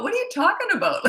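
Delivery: very high, dopey puzzlement; mock bewilderment. Daughter's account of her forgetting, mock bewildered. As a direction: squeak it out, utterly clueless. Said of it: "I get really dopey".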